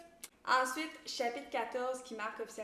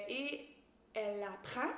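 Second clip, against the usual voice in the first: usual speaking voice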